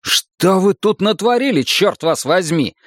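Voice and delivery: high-pitched indignant outburst; exasperated, heated question; exploding in anger. A narrator's reading. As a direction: burst out angrily, demanding an answer. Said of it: "взорвался я".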